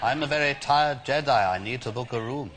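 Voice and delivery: lightly voiced weary Jedi; a hint of a tired old Jedi; Obi-Wan Kenobi impression. Prank caller as Obi-Wan booking a hotel room. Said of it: "Obi-Wan Kenobi".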